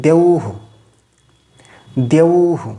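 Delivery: "দেউহু" with long ou and short hu; visarga as hu. Shown as a demonstration. Teacher shows visarga after ou, demonstrating pronunciation. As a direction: hold the ou in "দেউহু", then a brief hu. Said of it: "ঔকার দিয়ে".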